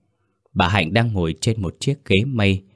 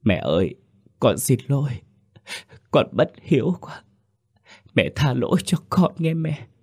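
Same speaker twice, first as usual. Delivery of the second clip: a higher, tearful plea for forgiveness; sobbing plea; 6 semitones higher than usual. A narrator's reading. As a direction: beg forgiveness through tears, voice climbing. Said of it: "khóc nức nở". (nức nở cầu xin mẹ tha lỗi)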